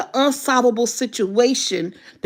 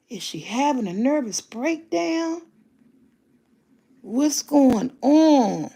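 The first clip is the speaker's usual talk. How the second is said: imitating a puzzled onlooker, drawn out; mock bewilderment; 3 dB quieter, slower than usual. Onlookers reacting when she explodes, mock bewilderment. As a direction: slowly wonder aloud, mimicking baffled bystanders.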